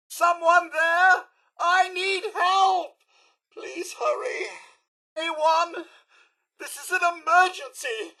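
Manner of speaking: high, slow, frightened plea for someone to come; panicked call for help. Acted. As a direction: call out into the dark, scared and alone. Robot panicking, calling for help.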